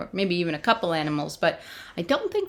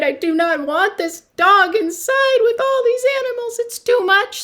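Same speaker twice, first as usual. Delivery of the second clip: high, louder exasperated complaint; fed-up Peter; 14 semitones higher, 4 dB louder, faster than usual. Acted. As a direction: whine that it's all too much. Peter upset by dog.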